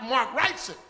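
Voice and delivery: normal talking voice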